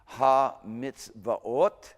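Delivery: slow, careful Ha mitzvot; slow reading. Shown as a demonstration. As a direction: read Ha mitzvot slowly for the class. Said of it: "it's just reading it normally".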